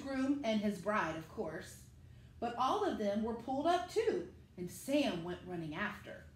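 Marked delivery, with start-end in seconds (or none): none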